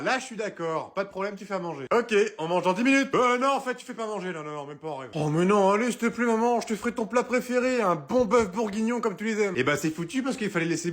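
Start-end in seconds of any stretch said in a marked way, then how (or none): none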